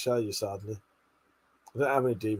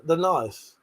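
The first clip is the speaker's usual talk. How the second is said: normal talking voice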